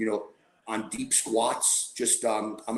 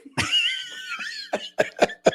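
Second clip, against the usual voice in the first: shrieking laugh; high wheezing cackle; high burst of laughter; 3 dB louder than usual. Real, not acted. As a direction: explode into laughter. Big laugh after punchline.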